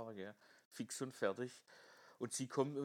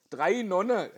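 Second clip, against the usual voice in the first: shouted, much louder; loud punchline; 6 semitones higher, 14 dB louder, slower than usual. Retold or imitated. Bruder vermasselt den Witz. (laut herausgeplatzt)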